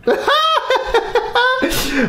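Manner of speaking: long, very high cackle; helpless high-pitched laughing; high-pitched exasperated laugh. Real, not acted. Exasperated by the broken product.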